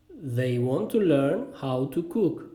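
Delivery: slow, careful model sentence; slow repeat. Shown as a demonstration. Teacher repeats the cooking sentence for learners, slowly. Phrase drill, slower repeat.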